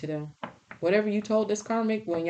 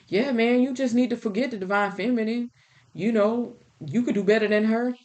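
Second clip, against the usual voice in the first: higher, louder bro voice egging him on; fake friend; 5 semitones higher, 4 dB louder than usual. Fake friends urging him to dump his partner, loud bro voice. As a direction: play the bad-advice buddy, loud and high. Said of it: "your fake friends co-signed on it".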